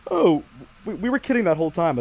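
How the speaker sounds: imitated surprised reversal; mock realization. Acting out a sudden story reversal.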